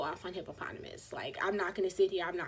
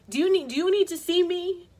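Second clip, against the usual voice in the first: loud, high challenge at the door; a raised confronting voice; retold confrontation; 9 semitones higher, 9 dB louder than usual. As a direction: call him out, loud and high. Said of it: "and Bill was like".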